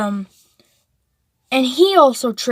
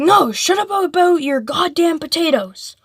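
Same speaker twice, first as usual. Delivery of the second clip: mock angry teacher; 6 semitones higher than usual. Teacher snaps at kid over potatoes.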